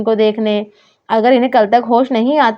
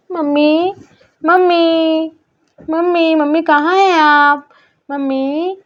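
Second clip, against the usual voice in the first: put-on higher child voice, worried and searching; worried little girl calling for mummy; 6 semitones higher, slower than usual. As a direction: play a small girl looking for her mother. Narrator voices child Aarna.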